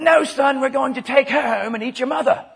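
loud, high mock British father; British accent. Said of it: "when you do it with a British accent".

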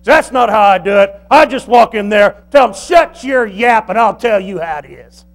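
loud acted telling-off; domineering parental shout, easing at the end; overbearing dad. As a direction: shout the teenager down as a mock dad. Acts dad overriding his teenager.

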